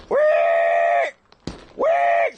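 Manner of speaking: long, loud squealing wee; pig squeal. Acted. Streamer playing the pig for chat, loud squeals. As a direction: squeal at full volume like a pig. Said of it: "Squeal like a pig".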